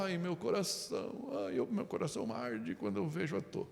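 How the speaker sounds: soft, swooning lovesick sighs; mock lovesick sigh. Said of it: "que não é só". (suspiro apaixonado, de brincadeira)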